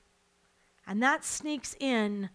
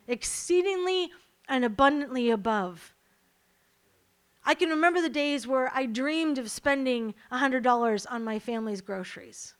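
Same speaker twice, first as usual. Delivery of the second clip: ordinary talk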